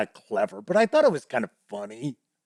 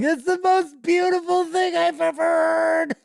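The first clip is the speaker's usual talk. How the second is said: much higher put-on goblin voice, overcome with emotion; tearful goblin leader. Goblin leader moved to tears by awful piano playing, high gushing voice. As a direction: gush like a moved little goblin chief. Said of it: "the leader of the goblins bursts into tears".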